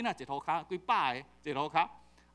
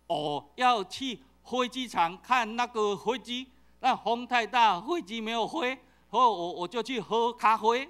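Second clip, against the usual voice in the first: slow, exaggerated Taiwanese accent, 飞机 said as hoi ji; Taiwanese-accented Mandarin; 5 semitones higher, 6 dB louder, slower than usual. Shown as a demonstration. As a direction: ham up a true Taiwanese speaker's Mandarin. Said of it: "这正港的台湾人咧讲国语". (慢慢夸张的台湾腔国语，"飞"念成 hoi)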